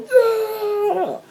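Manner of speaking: long, very high squealed cheer; triumphant whoop. Retold or imitated. Jumper carried by the crowd, whooping in triumph. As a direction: imitate an ecstatic stage diver. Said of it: "He's going".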